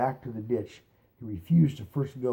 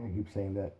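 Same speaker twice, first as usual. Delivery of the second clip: plain reading voice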